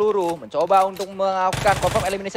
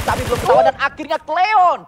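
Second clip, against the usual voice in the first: excited; 7 semitones higher, 5 dB louder than usual. Real, not acted. Caster calls a sudden kill.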